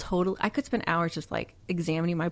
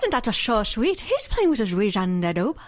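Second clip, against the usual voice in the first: high-flung, hammed-up Irish accent; 4 semitones higher than usual. Friend talked into copying the silly line, hammed-up Irish accent. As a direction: echo it in a playful mock-Irish voice. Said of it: "We have Irish in us".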